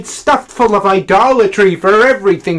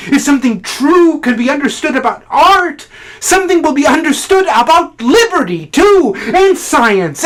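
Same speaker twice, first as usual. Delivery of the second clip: higher-pitched, driving declaration; impassioned tirade; 9 semitones higher, 5 dB louder than usual. Acted. Critic defending his whole purpose in life, impassioned. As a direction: build into a fervent, ringing argument. Monologue builds to impassioned outburst.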